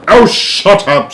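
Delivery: slow, high, loud dismissal; impatient. A narrator's reading. The President silences flattering Showler, scornful. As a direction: drawl it out with contempt.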